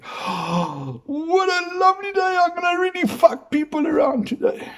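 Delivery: very high, sing-song sweetness; an imagined person waking up, hammed-up bright and sweet; mock cheery villain. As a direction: chirp it like a cartoon schemer. Mock morning person's thought.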